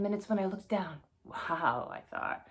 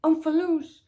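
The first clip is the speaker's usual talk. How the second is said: much higher, much louder zombie scream; 10 semitones higher, 11 dB louder than usual. Acted. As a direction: scream it up at the parents far above. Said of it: "I started screaming at them".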